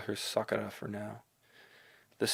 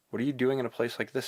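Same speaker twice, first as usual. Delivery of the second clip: ordinary delivery, nothing marked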